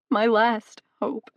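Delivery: slow, broken repeat of the plea; sobbing echo. A narrator's reading. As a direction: let it collapse into a sob. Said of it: "she sobbed into her hands".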